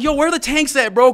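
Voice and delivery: loud, high urgent yell; re-enacted holler; hollered question. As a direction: shout across to strangers for directions.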